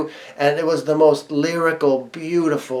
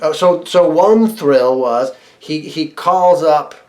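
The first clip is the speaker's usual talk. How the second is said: ordinary talk, nothing marked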